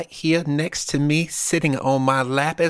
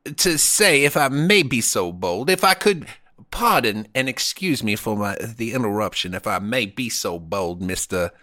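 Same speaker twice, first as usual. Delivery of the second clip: put-on Southern accent. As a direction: declare it like a genteel Southern host. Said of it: "He was a Southern".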